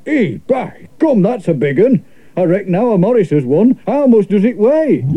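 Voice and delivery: a Yorkshire dignitary marvelling at a catch; Yorkshire Mayor. Acted. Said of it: "Cried the Mayor".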